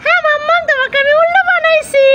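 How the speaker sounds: put-on high, cheeky little boy voice; child voice. Little son teasing his mother, in a high cheeky child voice. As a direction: play the teasing toddler answering mummy.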